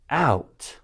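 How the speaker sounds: usual speaking voice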